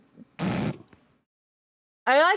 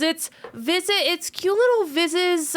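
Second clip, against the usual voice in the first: cooing over dog; 8 semitones higher, slower than usual. Real, not acted.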